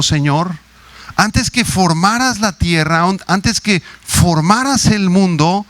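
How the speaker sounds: slow, lifted, rising on tú then sinking on Dios; fervent preaching. Acted. Pastor proclaiming God's eternity to his congregation, slow and fervent. Paraphrases the verse with fervour.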